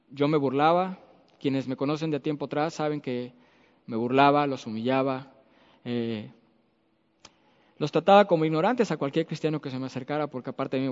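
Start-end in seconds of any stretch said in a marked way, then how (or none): none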